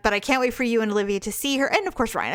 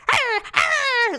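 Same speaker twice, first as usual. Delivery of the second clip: imitated puppy whine; 13 semitones higher than usual. Owner mimicking her puppy asking to go out, high yelping whine. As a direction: yip it like a puppy begging to get out.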